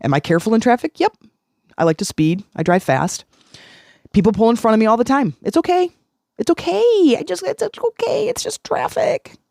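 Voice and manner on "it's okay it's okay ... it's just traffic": much higher, sing-song calming voice; soothing. Pretend. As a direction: coo it like calming a child. Soothing self-talk in traffic.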